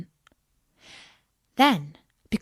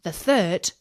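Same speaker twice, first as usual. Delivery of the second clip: plain reading voice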